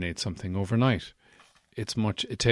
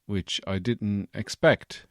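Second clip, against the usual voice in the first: ordinary delivery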